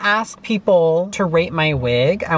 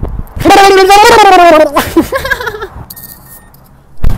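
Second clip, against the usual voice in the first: shrieking laugh; 14 dB louder than usual. Real, not acted. Shrieking laugh after underwater joke.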